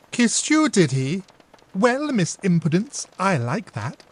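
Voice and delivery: high, loud mock outrage; playful big-sister scolding. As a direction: pretend to be shocked.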